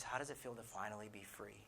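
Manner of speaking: hushed, low and tender; gentle reassurance. Retold or imitated. Dan asking the ashamed man about freedom, gently. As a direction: ask it softly and kindly, voice dropped. Friend's gentle question, retold.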